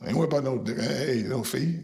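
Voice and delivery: normal talking voice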